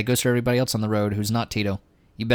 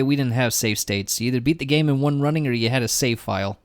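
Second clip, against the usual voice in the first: ordinary talk, nothing marked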